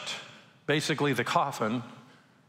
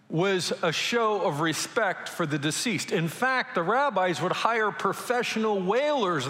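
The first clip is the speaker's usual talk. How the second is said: ordinary talk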